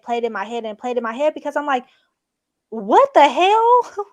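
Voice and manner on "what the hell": high, loud laugh-cry through the words; cracking up in disbelief. Real, not acted. Retelling the story to her cohost, laughing out loud.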